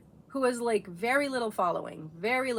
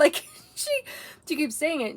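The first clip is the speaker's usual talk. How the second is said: laughing out loud, pitch jumping higher and louder; 7 semitones higher, 3 dB louder than usual. Real, not acted. Telling her daughter's TikTok story, laughing hard. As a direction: crack up while telling it. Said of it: "And I'm just laughing".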